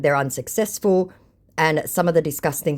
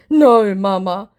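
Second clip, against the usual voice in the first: higher, slow, louder put-on scammer; stubborn, pleading scammer; mock scammer voice; 8 semitones higher, 7 dB louder, slower than usual. Scammer repeating his slip to the vlogger, mock voice. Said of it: "he repeats again".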